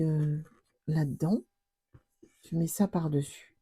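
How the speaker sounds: usual speaking voice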